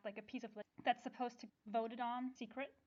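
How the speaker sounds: ordinary talk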